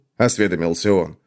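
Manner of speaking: usual speaking voice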